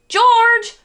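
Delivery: very loud, high exasperated yell; exasperated shout. A narrator's reading. Father shouting at George pulling down the shades, exasperated yell. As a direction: shout his name in exasperation. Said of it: "shouted Mister Little in an exasperated tone".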